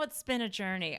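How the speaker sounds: ordinary talk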